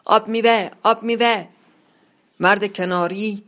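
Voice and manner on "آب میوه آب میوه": loud, higher call, repeated; shouting. A narrator's reading. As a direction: bark the order twice across the room. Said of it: "سرگروه فریاد زد".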